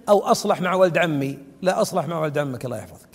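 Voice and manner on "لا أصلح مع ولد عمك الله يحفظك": low, soft and quick reply; quick dismissive answer. Retold or imitated. Sheikh answering the man who asks about his cousin, quick low reply. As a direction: toss the answer back fast and quietly.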